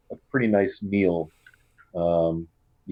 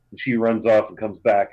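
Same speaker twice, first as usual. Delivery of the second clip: usual speaking voice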